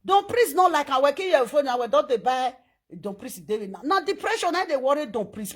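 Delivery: normal talking voice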